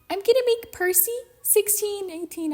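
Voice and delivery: high-pitched mocking voice; mocking dopey quote. Ranting fan quotes the filmmaker changing Percy's age, mockingly. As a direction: put on a high, silly voice for the quote.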